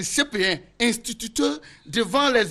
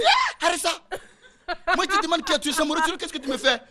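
exasperated teacher; 5 semitones higher, faster than usual. Retold or imitated. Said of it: "Je dis".

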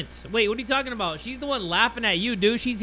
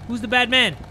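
ordinary talk, nothing marked

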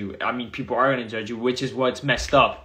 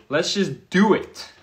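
higher, louder, slower pumped-up take; hyped-up repeat; 6 semitones higher, 5 dB louder, slower than usual. Retold or imitated. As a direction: sell the decision with energy. Retelling mindset, second take.

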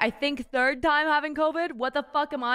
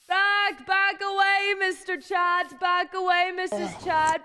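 playful shout; 7 semitones higher, 5 dB louder, slower than usual. Pretend. Shooing chat away.

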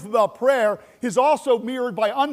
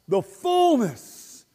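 The fullness drawn out slowly and loud; slow emphatic stress; 4 semitones higher, 3 dB louder, much slower than usual. Real, not acted. Preacher lingering on God's fullness, slow and weighty. Preacher dwells on key phrase.

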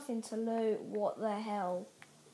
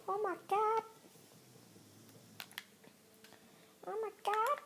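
amazed; 11 semitones higher, faster than usual. Real, not acted. Squeaky amazement.

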